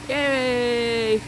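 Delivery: high, loud happy cheer. Real, not acted. Cheering the reopened pool.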